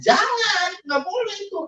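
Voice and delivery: very high-pitched retold warning; emphatic prohibition. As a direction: forbid it with voice shooting up. Acts out forbidding.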